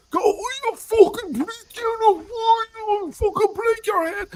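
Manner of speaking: high, slow mock North Dublin street-thug yell; North Dublin thug accent. Irishman showing how he sounds in a confrontation, high, slow North Dublin thug accent. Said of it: "I sound like the dirtiest, sewer scum from Dublin".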